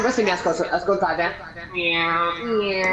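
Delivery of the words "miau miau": drawn-out imitation meow; verso del gatto. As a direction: mimic a cat with long, even meows. (miagolio imitato e allungato)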